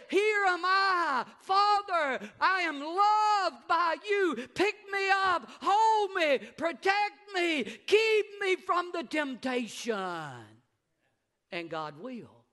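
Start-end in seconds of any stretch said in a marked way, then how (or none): 0.1-10.6 loud, high, slow pleading prayer; fervent louder plea to God; crying out to God. Pretend. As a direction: beg the Father to pick you up. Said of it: "You gotta say".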